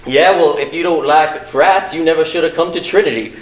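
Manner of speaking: lightly imitating a Southern frat boy; southern accent. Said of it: "I don't know why I'm doing a southern accent there".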